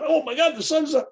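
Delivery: hammed-up gasp of amazement at "the sun's up"; mock excitement. Shown as a demonstration. The over-thrilled student he refuses to be, hammed-up excitement.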